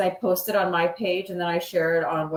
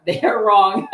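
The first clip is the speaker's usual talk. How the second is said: real laugh breaking through the words; laughing out the punchline, louder; 4 semitones higher, 9 dB louder than usual. Real, not acted. Cohost laughing while retelling Ron's quip about supervisors. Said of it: "I'm laughing".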